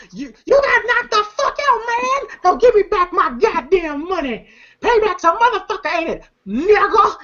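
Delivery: very high-pitched, hammed-up gloating; mock gloating taunt. Smokey demanding his money from knocked-out Debo, mock gloating. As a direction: taunt the loser in a high imitated voice.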